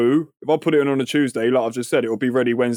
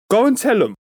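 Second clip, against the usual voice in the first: higher-pitched acted exasperation; mildly hammed-up fed-up command; exasperated order; 8 semitones higher, 4 dB louder than usual. Pharmacist sending him off to tell them, acted exasperated.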